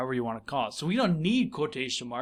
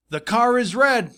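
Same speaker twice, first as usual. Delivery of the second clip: voicing the boy's line, higher and louder; emphatic quote; 8 semitones higher, 7 dB louder than usual. Teacher acting the boy's words for students, emphatic. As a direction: read the quote out with punch.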